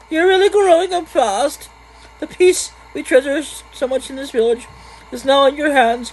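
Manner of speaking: high falsetto woman's voice; very high, proud falsetto; villager fondly praising young Otto. Acted. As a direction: put on a high, encouraging villager voice.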